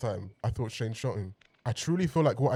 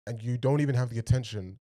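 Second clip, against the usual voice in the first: ordinary talk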